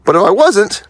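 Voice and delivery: normal talking voice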